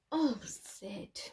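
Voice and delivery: ordinary delivery, nothing marked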